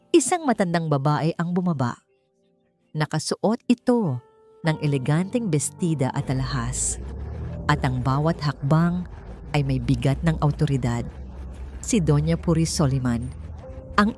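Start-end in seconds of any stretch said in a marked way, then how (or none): none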